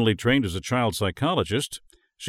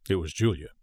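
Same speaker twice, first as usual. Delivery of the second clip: ordinary delivery, nothing marked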